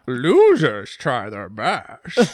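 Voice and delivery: slow, hammed-up Scottish film-star voice; swaggering Scottish movie-star impression; Sean Connery. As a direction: drag out each word with slushy sh sounds. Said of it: "My Connery?".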